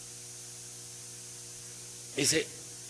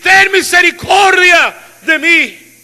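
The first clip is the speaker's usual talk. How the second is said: shouting; 10 semitones higher, 12 dB louder, slower than usual. Acted. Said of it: "él empezó a gritar".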